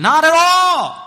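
high, loud burst; emphatic denial. Real, not acted. Preacher to congregation, a loud high emphatic no. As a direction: fling it out as a flat refusal.

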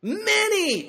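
Many cried out very high and drawn; stress on many. Shown as a demonstration. Preacher stressing the word Many to the congregation. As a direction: hit Many hard and high. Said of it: "you might want to underline that word".

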